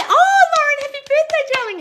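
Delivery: very high, loud gush; gushing birthday wish. Real, not acted. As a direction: squeal happy birthday. Birthday greeting.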